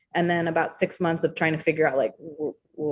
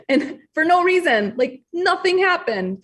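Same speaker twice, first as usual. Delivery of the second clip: laughing; 11 semitones higher, 4 dB louder, slower than usual. Real, not acted. Laughing recalling misery.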